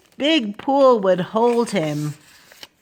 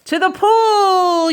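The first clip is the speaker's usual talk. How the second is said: drawn-out, raised, loud fireman's cry; fireman's yell; 9 semitones higher, 5 dB louder, slower than usual. A narrator's reading. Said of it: "yelled the fireman".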